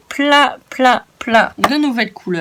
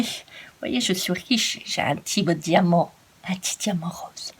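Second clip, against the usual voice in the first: whisper; 3 semitones lower, 10 dB quieter than usual. Pretend. Showing off a tiny diamond.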